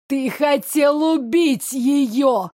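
ordinary delivery

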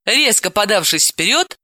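ordinary delivery, nothing marked

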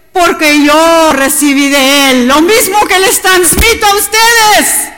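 proclamar más alto y fuerte, con ímpetu; predicación fervorosa. Acted. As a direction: predicar a toda voz a la congregación. (predicación con fervor)

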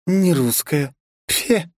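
scornful high huff; disdainful snort. Acted. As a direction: sniff with disdain. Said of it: "недовольно фыркнула".

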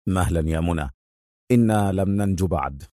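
low, soft, firm restraint. A narrator's reading. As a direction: hold her back with quiet firmness. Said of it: "قائلا في حزم".

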